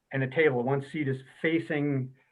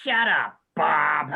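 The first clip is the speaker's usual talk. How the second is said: put-on little girl snapping; 5 dB louder, much slower than usual. Toddler Katie shouts back at Bob, loud mock outburst. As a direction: blurt it like a fed-up three-year-old. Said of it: "Katie looks at him and goes".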